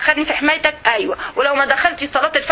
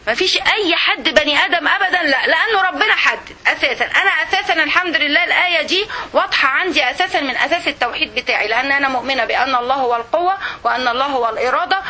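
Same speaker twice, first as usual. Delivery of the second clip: ordinary talk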